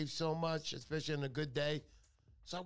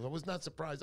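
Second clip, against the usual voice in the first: normal talking voice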